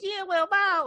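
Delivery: high, squeaky little demon; tiny demon voice. Retold or imitated. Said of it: "you hear his voice".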